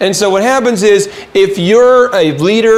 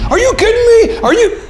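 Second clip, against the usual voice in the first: angry outburst. Retold or imitated. Imitating the likes-to-fight guy, in a high angry outburst. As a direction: blow up like the hothead coworker. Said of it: "he's angry".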